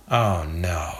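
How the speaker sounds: low, subdued dismay; sighing dismay. A narrator's reading. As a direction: let it sink like a sigh. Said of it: "He sighs".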